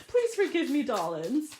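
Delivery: laughing through a plea for forgiveness; giggle, then beg sweetly while still laughing; sheepish laughing sorry; laughing apology. Real, not acted. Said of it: "So if I'm stuttering".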